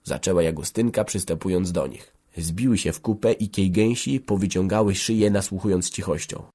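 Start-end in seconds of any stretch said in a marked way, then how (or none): none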